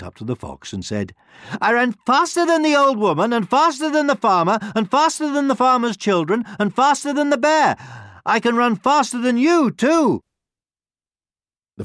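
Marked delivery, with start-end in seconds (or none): 1.6-10.2 high, loud gingerbread man voice; shrieky-high character boast; louder boast. A narrator's reading. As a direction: brag louder for a listener who can't hear. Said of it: "say that a little louder".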